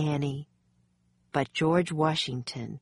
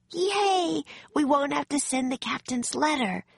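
put-on high-pitched little girl's cheer; gleeful, high squeal of a girl character; excited exclamation; 10 semitones higher than usual. A narrator's reading. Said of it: "Annie exclaimed".

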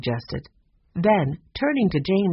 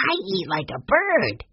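put-on higher-pitched boy's voice; little boy joking; 4 semitones higher than usual. A narrator's reading. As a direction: play the kid making a joke. Narrator voices young Benny joking.

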